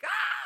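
piercing high scream; shriek. Shown as a demonstration. Preacher demonstrating a shriek to the congregation. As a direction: shriek like someone jumped out at you. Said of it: "It means to shriek".

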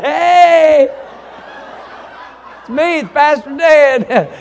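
loud, slow, laughing hello; cheerful laughing greeting. Retold or imitated. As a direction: call back to the honking driver, amused. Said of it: "I just turn around".

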